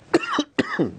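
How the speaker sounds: sharp real cough; coughing fit. Real, not acted. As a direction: cough mid-sentence. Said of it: "we can see you still have a cough".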